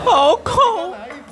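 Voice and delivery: laughing horror. Real, not acted. Listener reacting to ghost story.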